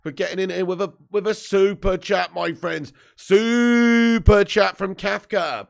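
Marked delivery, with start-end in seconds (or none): 3.2-5.7 announcer call. Acted. Announcing a super chat.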